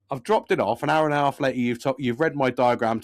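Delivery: ordinary delivery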